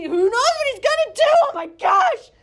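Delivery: high, loud panicked squeal; mock panic. Teacher voices Ruth's panic in the field, high loud squeal. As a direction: squeal it like she's freaking out. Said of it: "She's freaking out".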